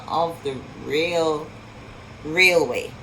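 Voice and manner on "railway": plain reading voice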